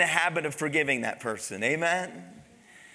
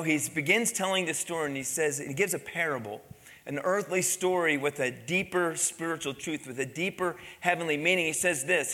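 usual speaking voice

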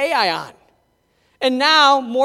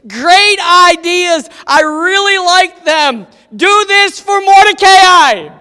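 king's voice, higher and much louder; the king proclaims grandly; booming king; 6 semitones higher, 11 dB louder than usual. Acted. King declares to Haman, loud and grand. King gives his orders.

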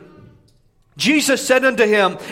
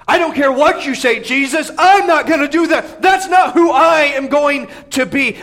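loud, higher, defiant protest; raised voice; 7 semitones higher, 4 dB louder than usual. Retold or imitated. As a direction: push it out, louder and higher. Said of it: "he now raises his voice to Jesus".

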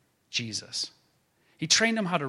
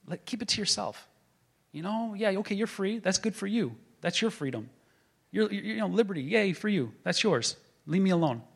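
higher, sing-song brush-off; lightly mock the scoffers' condescension; preacher imitating a dismissive critic, mildly mocking; mocking dismissal; 5 semitones higher than usual. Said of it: "we've been told over and over again".

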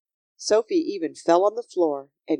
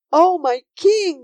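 high, loud, slow exclamation; awed cry; 11 semitones higher, 7 dB louder, slower than usual. A narrator's reading. Sophie kneels before her king, crying out in awe. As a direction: draw it out in adoring wonder.

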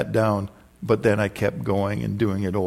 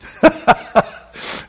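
high airy burst of laughter; high breathy laugh; 6 dB louder than usual. Real, not acted. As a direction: let out an unvoiced laugh. Laughs at the story.

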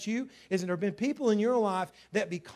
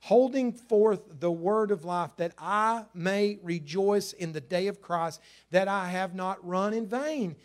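scripture reading; 3 semitones higher, 6 dB louder, slower than usual. Acted. Reads scripture slowly.

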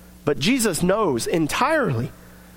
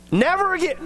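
repeated vow; 7 semitones higher, faster than usual. Retold or imitated. Acted vow, then relapse.

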